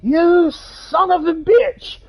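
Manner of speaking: high, drawn-out retold yell of rage; enraged outburst. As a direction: stretch it out like a furious curse. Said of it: "fucking go in a rage".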